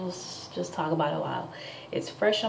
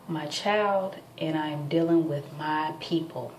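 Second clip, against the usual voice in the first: normal talking voice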